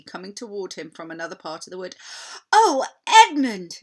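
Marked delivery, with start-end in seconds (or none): 2.5-3.8 loud, high, slow joyful call; cried out. A narrator's reading. Lucy spotting Edmund in the wood, crying out. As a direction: cry out his name in delight. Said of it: "she cried".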